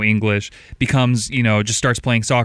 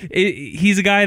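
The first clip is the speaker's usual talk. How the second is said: laughing; 8 semitones higher than usual. Real, not acted. Laughing at the dad remark.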